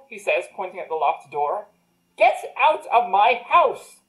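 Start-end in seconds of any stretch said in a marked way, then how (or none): 2.2-4.0 slow, louder, higher yell; angry order. Acted. Wizard ordering the gnome out of his house, angry. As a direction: spit each word out at the intruder.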